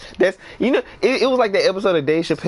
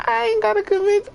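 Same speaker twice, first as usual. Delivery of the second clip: "I ain't gotta convince" squeaked out very high; squeaky high retort; faster than usual. Retold or imitated. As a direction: squeak the comeback meekly. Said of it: "I really wanted to say".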